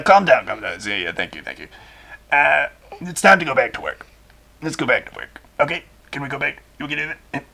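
lightly voiced cricket character; a hint of a chirpy cricket; Gricky Cricket voice. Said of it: "I should have done it in the Gricky Cricket voice".

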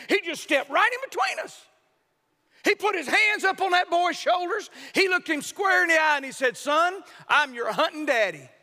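preacher building the story up high; rising excitement. Acted. Preacher reaching the climax for the congregation, excited.

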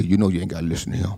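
low quick sneering aside; sly gossip. Retold or imitated. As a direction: hiss it low into the ear. Someone in Mike's ear.

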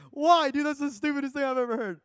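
high-pitched mocking incredulity; scoffing disbelief. Retold scoffing at Satan worshipper.

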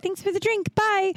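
high-pitched quick goodbye; breezy brush-off. Acted. Drinker ditching the fan who bought the round, breezy brush-off. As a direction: toss off a high, airy thanks and farewell.

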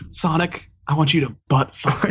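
slow sexual read, pausing after Sonic. Acted. Tails propositioning Sonic in a fanfic read, slow and sexual. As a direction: draw it out slowly, breaking into a laugh. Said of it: "Can you say that again, [name], but slower?".

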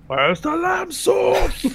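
high, very slow, stretched-out mock chef bellow; celebrity chef yell. Friend imagines his own cooking show, mock chef rant. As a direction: drag every word out like a raging TV chef. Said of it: "I wouldn't yell like".

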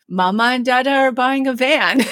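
imitating parent-to-child talk. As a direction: spell it out simply for a small child. Parent explaining to small child.

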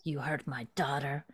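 whispered threat; menacing whisper. Acted. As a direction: hiss it as a quiet threat. Acting vengeful threat to villain.

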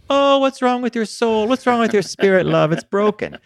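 high, loud mock psychic reading; imitating Miss Cleo. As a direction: ham up a TV psychic sensing your broken soul. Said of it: "She'd go".

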